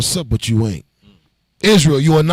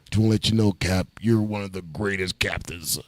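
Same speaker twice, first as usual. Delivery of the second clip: much lower, softer boozy flattery; drunk caller; 9 semitones lower, 10 dB quieter than usual. Retold or imitated. Drunk friend calling back to flatter, low and soft. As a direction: mumble the praise low like a drunk. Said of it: "He called back".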